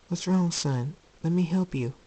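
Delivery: high, gentle motherly plea; concerned mother. Acted. Luna to her suffering son, gently worried. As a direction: offer help tenderly.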